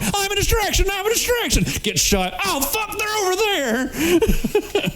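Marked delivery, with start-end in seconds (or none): 0.0-1.6 much higher, silly sing-song announcing itself; squeaky mock decoy. 2.5-4.9 mock panic. Decoy gets shot.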